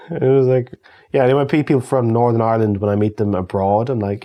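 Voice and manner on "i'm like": ordinary talk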